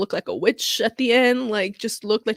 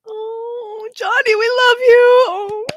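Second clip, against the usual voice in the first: much higher and louder, tearful and slow; emotional cry; 14 semitones higher, 8 dB louder, much slower than usual. Real, not acted. A tearful fan calls out her love to Johnny, high and crying. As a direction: cry it out with all your heart.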